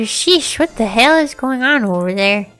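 usual speaking voice

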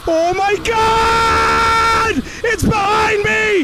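high, panicked yell of real fright; frightened shout. Real, not acted. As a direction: scream it as the monster appears behind you. Monster appears behind him.

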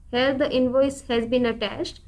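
normal talking voice

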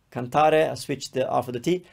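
ordinary talk, nothing marked

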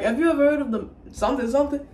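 fast high retold question. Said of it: "He's like".

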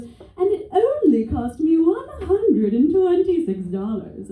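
high, slow bragging; proud snooty mom. Retold or imitated. The Florida mom bragging about the test price, high and slow. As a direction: savour the price like a show-off. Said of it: "and she goes".

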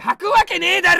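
loud, high denial with a laugh; laughing shout. Real, not acted. Streamer yelling back at a viewer's crude joke, laughing. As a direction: bellow it in disbelief.

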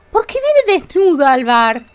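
slow, loud mock disbelief; drawn-out fake indignation; mock outrage. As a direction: act scandalised at the naked guest. Mock outrage at naked Sim.

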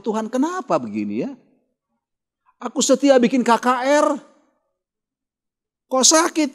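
a sick pastor complains tearfully to God, mock crying; mock crying complaint. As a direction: retell his tearful protest to God in a half-hearted weepy voice. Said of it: "Oh, nangis dia".

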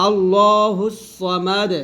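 very slow "আল্লাহুস সামাদ" with a heavy emphatic সদ; Quranic recitation. Shown as a demonstration. Teacher reciting the verse to show the correct sad, slowly. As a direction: recite "সামাদ" drawn out, sad sound thick. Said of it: "এখানে সদ যাব স".

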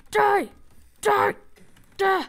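short, loud, high cries; attacking shout. Pretend. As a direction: yell with each hit. Attacking mobs in game.

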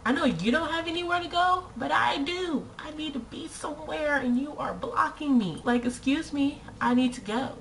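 high, hurried mock complaint; exasperated. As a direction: push it out high and impatient. Imagined rant at slow walkers.

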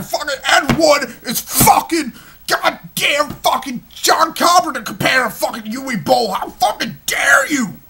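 mock outraged fan yelling, high and loud. Imitating a fan shouting back at the host, mock outrage. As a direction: shriek like an offended Ed Wood fan. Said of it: "a lot of people are probably gonna be like".

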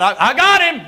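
high, laughing triumph; triumphant. Retold or imitated. Monroe crowing to the crowd after grabbing the wrestler, triumphant. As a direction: crow about the catch, laughing. Friend hauls wrestler from ring.

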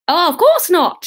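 high, loud, hasty reassurance; flustered vicar. A narrator's reading. Vicar hastily agrees in story.

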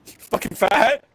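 mock yelled insult. Real, not acted. Yelling at a teammate who fumbled the jump, mock insult. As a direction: shout "You fucking fat!" at him, half laughing.

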